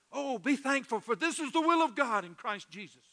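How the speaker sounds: loud, high-pitched scripture exhortation; fervent proclamation. Acted. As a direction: proclaim the verse with full preaching force.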